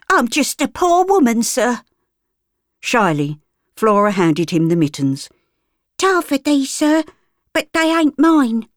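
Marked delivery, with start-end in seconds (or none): 0.1-1.8 higher, whining cockney; playing the poor victim; cockney woman. Gert whines to Sir Arthur, pitiful. 6.0-8.7 put-on high, shy cockney girl; a shy child being honest; street girl. Flora shyly returns the mittens, high cockney. Flora speaks to Sir Arthur.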